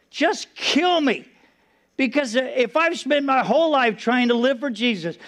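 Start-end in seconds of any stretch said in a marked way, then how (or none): 0.1-1.2 higher, very slow, drawn-out plea; mild retold despair, raised and slowed; pleading cry. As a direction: stretch it out, begging.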